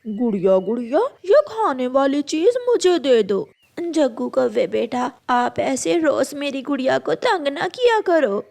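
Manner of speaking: usual speaking voice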